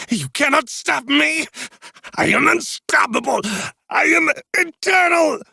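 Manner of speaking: high, crazed villain ranting; mad king. A narrator's reading. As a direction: gloat like an unhinged tyrant. Mad king gloating mid-battle.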